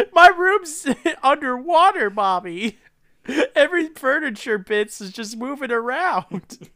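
put-on high, loud whiny child; a kid protesting to his mommy; little boy voice. The drowned son answering his mother, childish.

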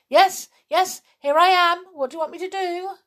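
high-pitched, excited rush of words; over-eager. A narrator's reading. Bear rushing up when his name is called, over-eager. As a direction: rush in keen to help. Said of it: "rush up whenever his name was called".

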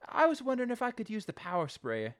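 put-on a boy politely hoping for a yes; hopeful kid. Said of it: "he's like".

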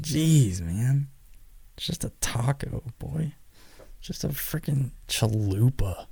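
soft, slow mock mutter; muttered grumble. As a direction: grumble it under your breath. Muttering about stare.

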